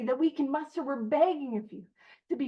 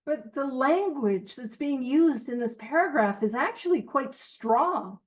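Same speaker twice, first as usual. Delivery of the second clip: normal talking voice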